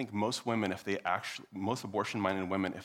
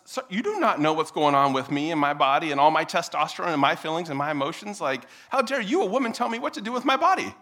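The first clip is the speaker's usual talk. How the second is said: loud mock outrage, climbing higher; man's indignant protest; 7 semitones higher, 8 dB louder than usual. Man protesting a woman's intervention, mounting indignation. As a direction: build a pretend self-righteous rant. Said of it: "And the man says".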